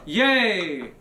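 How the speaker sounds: high happy cheer; a bright little yay. Real, not acted. Cheer to chat.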